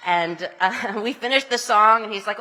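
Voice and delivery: normal talking voice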